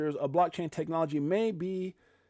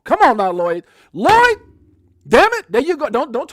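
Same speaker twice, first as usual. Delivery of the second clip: loud, high shout at Lloyd; laughing yell; 7 semitones higher, 13 dB louder than usual. Real, not acted. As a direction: call him out loudly. Yelling at a chat member.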